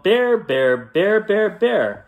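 high rhythmic yell for a bear; storybook yelling; yelled call. A narrator's reading. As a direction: call it out in a loud chant. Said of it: "and he yelled".

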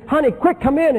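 retold urgent summons; urgent calling. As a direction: call out in a hurry. Retold man urgently calls his wife.

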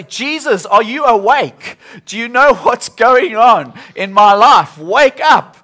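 loud, high, pleading cry; anxious shouted prayer; desperate plea. Retold or imitated. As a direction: plead with Jesus as the boat fills. Voicing our cry to Jesus.

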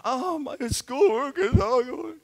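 high, drawn-out whine about school; overwhelmed kid griping to a parent. Acted.

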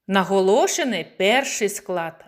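usual speaking voice

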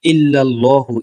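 লা ইলাহা ইল্লাল্লাহু drawn out slowly in Arabic recitation. Shown as a demonstration. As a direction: chant লা ইলাহা with the long Alif held. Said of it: "না হয় অর্থ পরিবর্তন হয়ে যাবে". (ধীরে টেনে আরবি তিলাওয়াত)

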